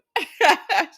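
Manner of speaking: loud burst of laughter; real laugh. Real, not acted. Vlogger laughing at Sheila's comeback, loud laugh. Laughing after "Well, Lord.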